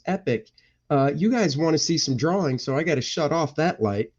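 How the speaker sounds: ordinary talk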